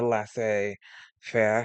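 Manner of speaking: laissez-faire drawn out slowly in a tentative French accent; a modest go at French pronunciation of laissez-faire; attempted French accent. Shown as a demonstration. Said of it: "I'm trying to say it with a French accent".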